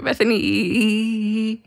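a long, drawn-out Bethany; drawn-out name. Retold or imitated. Teasing her cohost by dragging out her name. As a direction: stretch the name out slowly, trailing off. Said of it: "like you forgot my name halfway through".

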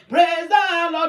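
slow, loud declaration of praise; fervent praise. Acted. Believer praising with fire on the mountain, slow and loud. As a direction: proclaim it steadfastly, drawing the words out. Acting steadfast praise amid trouble.

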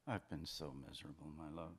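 low, soft confession; miserable. Acted. Man confiding in his lover, miserable. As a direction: confide it quietly, pitch dropped.

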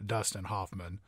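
a lightly done deep narrator voice; Morgan Freeman impression. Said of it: "I'm Morgan Freeman".

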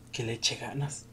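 ordinary delivery

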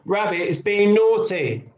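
put-on high-pitched child's complaint, called out; mild shout. A narrator's reading. Billy blaming Rabbit to Mum, mildly shouting. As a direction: tell on the toy in a high call. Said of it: "shouted Billy".

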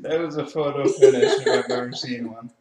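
laughing. Real, not acted. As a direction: laugh while calling it. Laughing after close finish.

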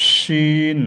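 শিন with a whistling snake-like hiss; long sibilant shin, like a snake hissing. Shown as a demonstration. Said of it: "ঠিক সেইভাবেই আমরা এই শব্দটা তৈরি করব".

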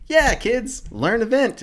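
high, loud singsong teacher voice; mock cheery teacher. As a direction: chirp it like a cheesy kids' host.

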